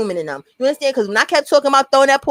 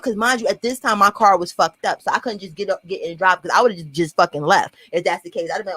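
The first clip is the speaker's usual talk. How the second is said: ordinary talk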